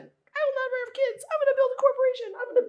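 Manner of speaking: high, far-off mock voice of a hard-driving executive; tough younger self. Woman mocks her younger career self, hammed-up high voice. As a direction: caricature your old ambitious self. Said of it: "This is when I was like".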